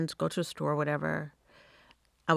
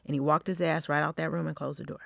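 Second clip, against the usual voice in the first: usual speaking voice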